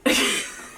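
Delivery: brief breathy-voiceless chuckle; short amused laugh. Real, not acted. Wife laughing at husband's joke about symptoms, amused.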